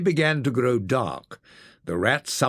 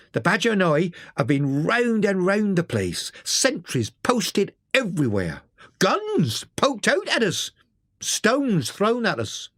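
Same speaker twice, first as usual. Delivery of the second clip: higher-pitched Mole reporting bad news; weary, troubled Mole; 8 semitones higher than usual. A narrator's reading. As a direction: give a grim report in Mole's high voice.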